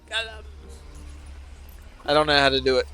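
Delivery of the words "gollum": a hint of choking on Gollum. Streamer attempting the choking Gollum voice. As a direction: try to choke out Gollum, softer. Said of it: "choking on the word Gollum voice".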